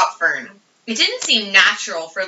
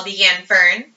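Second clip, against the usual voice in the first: plain reading voice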